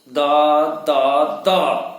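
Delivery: angry clipped cries of pain; annoyed toothache yelps. Acted. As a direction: spit out the syllables in irritated pain. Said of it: "मी चिडून म्हणालो".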